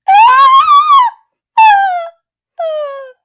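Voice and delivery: very high, loud screams tailing off; piercing mock-horror screams; shrieks of horror. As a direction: shriek over and over, weakening. Mock horror at killed cat.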